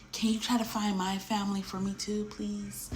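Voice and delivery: worried passenger asking to find her family; soft plea. Retold or imitated. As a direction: drop to a near whisper on please. Pleading request.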